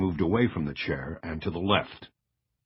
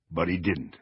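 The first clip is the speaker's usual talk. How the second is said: ordinary delivery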